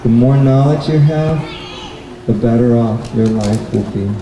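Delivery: ordinary delivery, nothing marked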